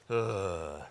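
low, soft bored groan. Retold or imitated. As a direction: groan like a student who's checked out. Contrasting bored student groan.

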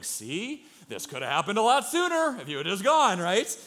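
lightly voiced God, exasperated; God scolding Jonah. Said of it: "God's just up there in heaven looking at Jonah going".

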